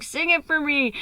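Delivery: high, loud mock drunken urging; drunk friend. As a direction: imitate a tipsy friend egging you on.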